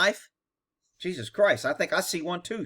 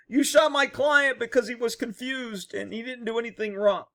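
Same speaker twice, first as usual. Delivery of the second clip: mock defense attorney; 5 semitones higher than usual. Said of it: "would a defense attorney be".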